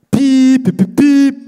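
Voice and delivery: high, loud pip pip pip; loud beeps. Acted. Slam poet mimicking horn beeps, high and loud. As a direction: punch out the beeps.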